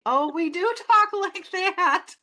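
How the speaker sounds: high, slow mock realisation breaking into laughter; exaggerated Minnesota accent. Host admitting Minnesotans do talk like that, hammed-up accent. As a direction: draw it out high and loud, laughing at yourself.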